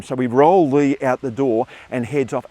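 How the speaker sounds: ordinary talk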